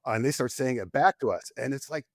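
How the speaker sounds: usual speaking voice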